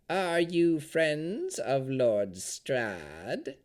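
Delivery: slow, drawn-out withered hag voice; old crone voice. A narrator's reading. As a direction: creak it out like a dried-up hag. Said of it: "asked through shriveled lips".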